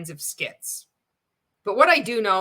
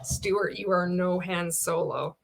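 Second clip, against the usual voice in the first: ordinary talk, nothing marked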